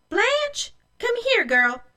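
high, loud summoning call. A narrator's reading. Mother summons Blanche, calling out. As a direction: call the girl over sharply, pitch jumping up. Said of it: "Mother called Blanche".

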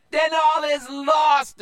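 very high, loud wail of despair; despairing pirate. A narrator's reading. Panicked pirate wailing to his crewmates. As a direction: cry out that all is lost. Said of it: "another said".

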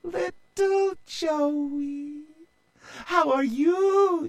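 very high, slow tender voice; tender sister. A narrator's reading. Sister tenderly greeting her little brother, very high. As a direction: melt with affection. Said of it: "she said".

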